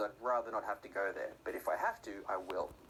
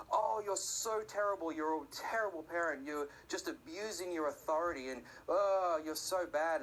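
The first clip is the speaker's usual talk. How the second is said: high mock whine of protest; hammed-up kid's complaint; complaining child; 6 semitones higher than usual. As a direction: play an outraged little kid, pitched up. Child protesting at parent's refusal.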